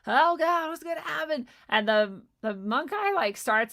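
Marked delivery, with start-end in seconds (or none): 0.1-1.5 high, loud mock panic; hammed-up fright, much higher and louder. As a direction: act out a panicked Ram Dass. Ram Dass panicking about the monk.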